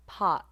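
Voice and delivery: "Pot" loud with a glottal stop at the end. Shown as a demonstration. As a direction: catch the t in the throat in "Pot". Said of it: "I'm releasing at the glottis".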